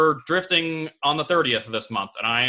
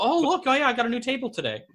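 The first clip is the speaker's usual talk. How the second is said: mock innocent; 12 semitones higher than usual. Mock excuse to girlfriend.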